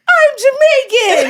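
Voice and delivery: high, slow, laughing answer; laughing reply. Retold or imitated. Child answers scolding aunt, high and laughing. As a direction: squeak it out through a laugh. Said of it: "I was like".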